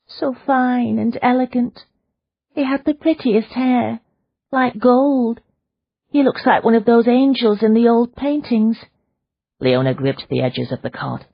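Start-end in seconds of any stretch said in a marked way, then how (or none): 0.1-8.8 wistful. Acted. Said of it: "voice changed to a wistful sigh".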